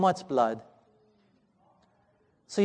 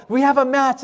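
higher-pitched excited announcement; imitated joy; 5 semitones higher than usual. Everyone celebrating the son's match, imitated joyful cry. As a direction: echo a crowd's joyful outburst. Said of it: "everyone erupts with joy again".

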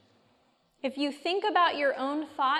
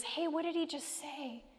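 softly, as if leaning over to a neighbour; hushed aside; 2 semitones higher, 6 dB quieter than usual. Acted. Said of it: "leans over and says".

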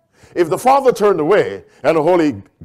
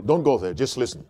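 ordinary talk, nothing marked

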